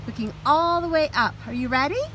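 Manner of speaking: ordinary delivery, nothing marked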